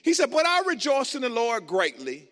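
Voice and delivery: loud, high sermon delivery; preaching. Acted. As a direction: proclaim the message to the crowd.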